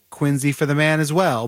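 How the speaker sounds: Quincy with a Z, QUIN-zee; variant pronunciation. Shown as a demonstration. Author reporting what some will say, Z pronunciation of Quincy. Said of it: "some will say".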